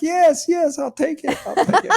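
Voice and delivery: very high, laughing squeals of yes; laughing acceptance. Retold or imitated. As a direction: squeak it out, unable to hold back laughter. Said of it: "but I was laughing".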